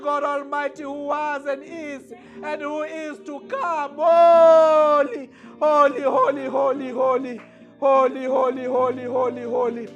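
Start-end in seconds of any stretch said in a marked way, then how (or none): none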